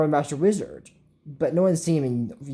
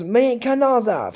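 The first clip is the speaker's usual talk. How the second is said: higher, slowed spell words; slow spell incantation; 6 semitones higher, 3 dB louder, slower than usual. Retold or imitated. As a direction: intone the spell slowly. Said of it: "Then he goes".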